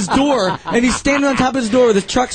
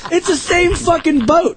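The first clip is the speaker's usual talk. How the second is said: high, laughing exasperation at a friend; half-laughing, insisting it's the same boat; exasperated retort; 9 semitones higher than usual. Retold or imitated. Telling his kayaking buddy the boats match, exasperated laugh. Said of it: "I'm like".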